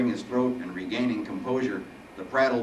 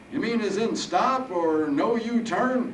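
put-on high, slow, loud old-man musing; puzzled old Harold; 12 semitones higher, 5 dB louder, slower than usual.

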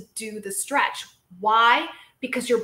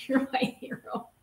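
laughing; 3 semitones higher, 5 dB quieter than usual. Real, not acted. Repeat with laughter.

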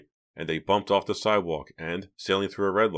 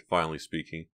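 ordinary delivery, nothing marked

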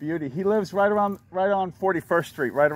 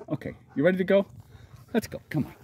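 ordinary talk, nothing marked

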